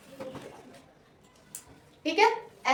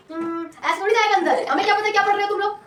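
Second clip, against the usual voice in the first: lightly voiced sulky kid, higher; mock complaining student; mock student grumble; 4 semitones higher than usual. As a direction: grumble like a bored pupil, a hint put on. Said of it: "अब तुम बोलोगे नहीं".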